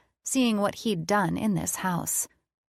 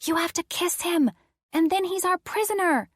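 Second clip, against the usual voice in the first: whisper; 11 semitones higher than usual. Acted. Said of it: "whisper in my ear".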